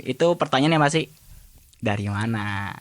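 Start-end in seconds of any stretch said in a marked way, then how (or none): 1.8-2.8 a softer, lower knowing "Dari mana?"; quiet, knowing ask; knowing question. Shown as a demonstration. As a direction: ask "Dari mana?" as if you already know the answer. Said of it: "orang yang sudah tahu nih kejadiannya".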